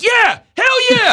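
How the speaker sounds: high, loud whooping shout of a fan going nuts; wild fan cheer. Retold or imitated. Stadium fan celebrating a big catch, screaming wildly. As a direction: scream it like a stranger leaping out of his stadium seat.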